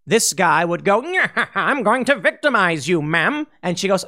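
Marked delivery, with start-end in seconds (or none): 1.6-3.5 put-on thug voice with a laugh; cartoon bad guy making a threat; lightly hammed-up criminal; mock villain. Pretend criminal threatens a woman.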